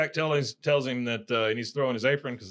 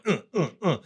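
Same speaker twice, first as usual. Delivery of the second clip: short higher-pitched dance grunts; rhythmic grunts; 5 semitones higher than usual. Retold or imitated. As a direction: grunt in time, imitating a butt shake. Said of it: "just going".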